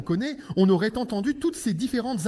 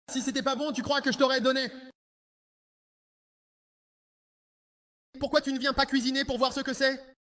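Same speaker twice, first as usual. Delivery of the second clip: a sweet-looking wife's harsh rant; angry wife. Acted. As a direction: berate your husband furiously. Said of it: "un tel ton de voix et puisse parler ainsi".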